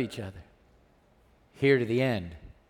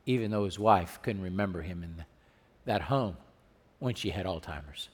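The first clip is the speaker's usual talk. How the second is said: much softer, tender and hushed; 11 semitones lower, 13 dB quieter than usual. Real, not acted. Pastor telling the church about a husband's devotion, hushed and tender. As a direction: lower the voice to tell a moving story. Tender story of Alzheimer's love.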